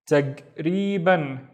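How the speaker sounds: "Tagriban" drawn out very slowly, syllable by syllable; slow, spelled-out "Tagriban"; slow syllable blend. Shown as a demonstration. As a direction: stretch "Tagriban" out slowly for learners. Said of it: "slowed demo of word".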